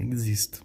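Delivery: "Existe" as eg-zist, falling at the end; French X sound. Shown as a demonstration. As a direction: make the X in "Existe" a GZ. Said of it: "Um G com Z".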